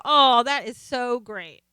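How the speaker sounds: slow, drawn-out "Oh, that is so great"; delighted exclamation. Retold or imitated. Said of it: "you'll go".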